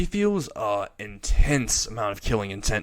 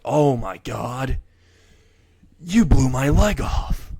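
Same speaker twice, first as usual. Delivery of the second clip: mock shock; 2 semitones higher, 5 dB louder, slower than usual. Leg blown off, mock shock.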